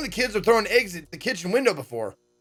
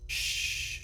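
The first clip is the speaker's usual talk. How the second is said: sharp unvoiced shush; whispered hush; 11 dB quieter than usual. Kid hushing urgently.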